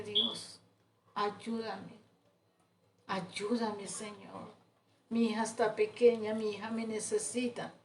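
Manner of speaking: soft, hushed plea to God, as if crying; retold tearful plea. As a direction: imitate a weeping prayer, kept very quiet. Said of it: "yo ese día llorando".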